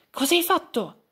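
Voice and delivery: railing. A narrator's reading. Radice Curva railing at Pignetto, high and loud. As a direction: lash out at him. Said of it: "inveì Radice Curva".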